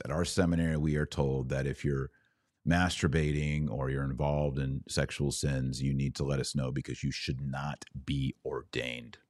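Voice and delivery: normal talking voice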